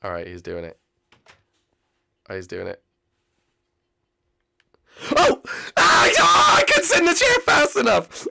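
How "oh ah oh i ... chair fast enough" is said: very high, loud yell of defeat; frustrated shout. Real, not acted. Out of musical chairs, shouting at losing the seat.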